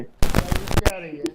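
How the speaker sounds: slow, faint, broken-up audio; crackling bad connection; clipping distorted mic. Real, not acted. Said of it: "फट, फट, फट रही है।". (फटती हुई धीमी माइक आवाज़)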